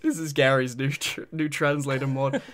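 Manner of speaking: deep robotic device voice. Pretend. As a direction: speak like a gadget's canned pitch. Voicing Gary's translator device.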